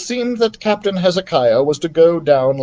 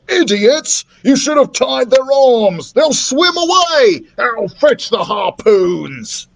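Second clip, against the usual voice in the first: raging captain; 10 semitones higher, 4 dB louder, slower than usual. A narrator's reading. Captain raging at his crew.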